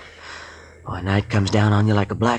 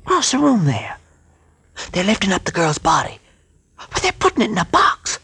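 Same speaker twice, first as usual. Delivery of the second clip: rising alarm, pitched much higher; 11 semitones higher than usual. Acted. Skip tells the captain what he sees through the crack, alarmed. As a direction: voice climbing in horror as he watches. Watching girl put in coffin.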